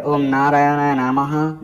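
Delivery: drawn-out intoned prayer; slow devotional chant; very slow mantra. Acted. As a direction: stretch out each syllable reverently. Recites short mantra.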